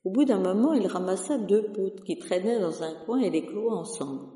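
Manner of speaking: ordinary delivery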